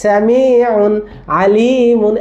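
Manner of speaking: Samiun stretched out very slowly, with full long vowels; slow drill reading. Shown as a demonstration. Teacher asking the parents to repeat, slow and drawn out. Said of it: "বলেন সামিউন".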